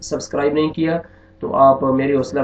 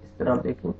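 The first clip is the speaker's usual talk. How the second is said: a whispered pointer; whispered aside; 9 dB quieter than usual. Real, not acted. Teacher whispering while pointing at the next word. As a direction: say it under the breath.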